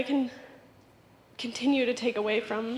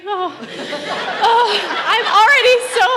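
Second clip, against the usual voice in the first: laughing; 7 semitones higher, 12 dB louder than usual. Real, not acted. Laughing at her own tearfulness.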